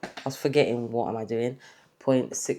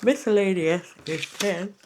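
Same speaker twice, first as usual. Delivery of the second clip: said through a yawn; 6 semitones higher, 5 dB louder than usual. Real, not acted. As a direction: yawn while saying it. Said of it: "Sorry, I am so sorry for yawning".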